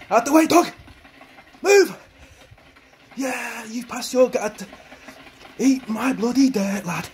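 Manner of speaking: very high, loud yelling while sprinting; shouting. Pretend. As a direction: bellow at the dog to get out of the way. Sprinting past the dog in game.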